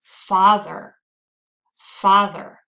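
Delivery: "Father" with a loud, strong first syllable; stress on first syllable. Shown as a demonstration. Teacher quizzing learners on stressed syllable, loud first syllable in "Father". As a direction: punch the "Fa" in "Father". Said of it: "it's the first syllable".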